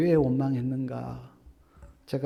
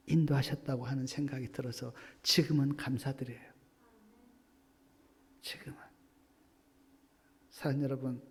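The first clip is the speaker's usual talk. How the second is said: usual speaking voice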